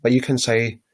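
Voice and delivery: ordinary talk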